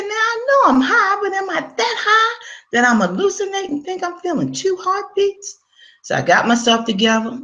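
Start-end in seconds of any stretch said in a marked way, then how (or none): 0.0-5.6 high, startled and half crying; shocked disbelief. Retold or imitated. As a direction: cry it out in stunned disbelief, much higher. Shock at feeling two heartbeats.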